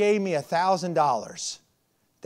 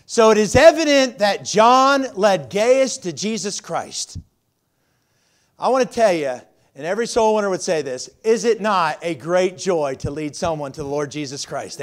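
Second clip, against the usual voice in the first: preaching at full force; 6 dB louder than usual. Acted. Preaching sermon.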